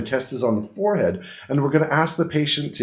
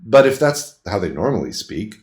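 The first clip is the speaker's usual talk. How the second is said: ordinary talk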